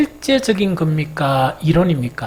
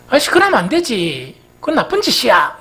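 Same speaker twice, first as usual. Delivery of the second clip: higher, louder exasperated telling-off; exasperated scolding; 7 semitones higher, 5 dB louder than usual. Retold or imitated. The sister tells off the robber, exasperated. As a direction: scold him like a naughty child. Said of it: "억수로 기가 차니까 뭐라 캐본 거야".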